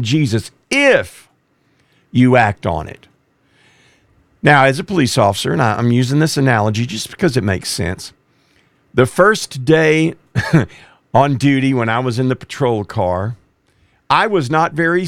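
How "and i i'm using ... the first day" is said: ordinary talk, nothing marked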